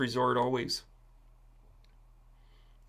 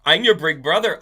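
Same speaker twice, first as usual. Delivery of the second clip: mock big brother shout; 4 semitones higher, 11 dB louder than usual. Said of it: "Putin is working on the Pisces model".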